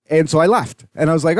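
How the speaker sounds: normal talking voice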